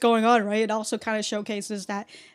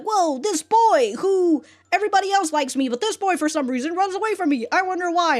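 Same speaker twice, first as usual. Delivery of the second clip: high, lightly mocking lovestruck girl; girly swooning voice; 7 semitones higher, 3 dB louder than usual. Reviewer mimics Azami pining for the boy, high and swooning. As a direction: play her as a smitten schoolgirl, half joking. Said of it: "and she's just like".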